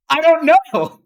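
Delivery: high, loud mock wail of cluelessness; retold laughing outburst. Patient answers her doctor while everyone laughs, loud high outburst.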